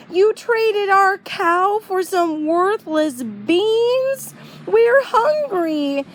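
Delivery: high, slow, exasperated scolding; a mom dismayed at a silly trade; upset mom. A narrator's reading. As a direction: mom scolding Jack over the worthless beans. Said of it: "His mom was not happy with the trade".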